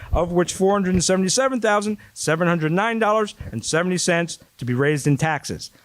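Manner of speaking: ordinary talk, nothing marked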